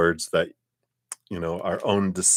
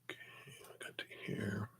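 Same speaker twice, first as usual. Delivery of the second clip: whispering; 3 semitones lower, 15 dB quieter than usual. Real, not acted. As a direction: whisper under your breath. Muttering while taking screenshots.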